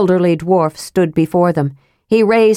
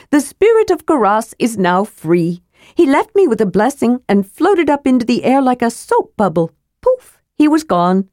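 higher, relishing kender storyteller; kender spinning a tale; 5 semitones higher than usual. Acted. Kender enjoying his tall tale to the dwarves, relishing. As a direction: ham up the ghost story with glee.

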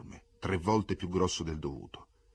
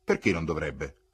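dry, louder comeback; curt retort; 7 dB louder than usual. A narrator's reading. Charles to a doubting bystander, curt retort. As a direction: answer back curtly. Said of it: "replicò seccamente Charles".

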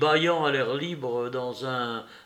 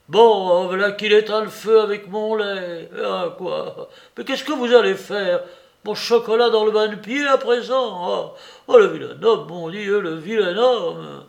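high, loud wailing complaint; dismayed lament; 5 semitones higher, 6 dB louder than usual. A narrator's reading. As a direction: lament the disaster in helpless outrage. Old man wails at the meddling.

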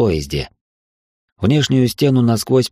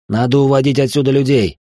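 urgent call, pitched higher; raised voice; 5 semitones higher, 3 dB louder than usual. A narrator's reading. Urging Alexei to evacuate the guests, raised voice. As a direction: call it out over the commotion. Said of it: "громко произнёс я".

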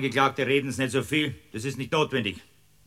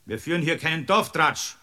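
usual speaking voice

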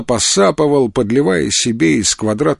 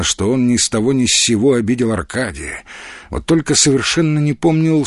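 ordinary delivery

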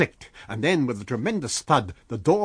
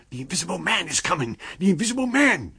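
higher, rising panicked cry; alarmed shout; 7 semitones higher, 5 dB louder, faster than usual. A narrator's reading. Townsfolk raising the alarm.

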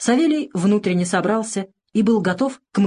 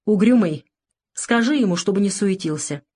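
usual speaking voice

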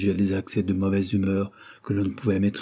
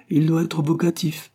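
put-on higher, firm boyish insistence; insistent; 8 semitones higher than usual. A narrator's reading. William correcting Henry's Latin, insistent. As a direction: hold your ground on the correction. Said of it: "insista William".

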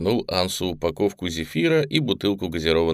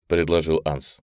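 usual speaking voice

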